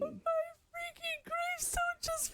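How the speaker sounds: high, slow crying wail; dying in the game, high slow wail; anguished wail. Real, not acted. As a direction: wail over your fallen gravestone.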